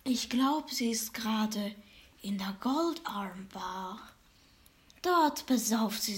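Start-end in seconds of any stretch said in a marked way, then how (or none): 0.7-4.0 fortune teller. Acted. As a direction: whisper it like a secret. Fortune teller gives directions.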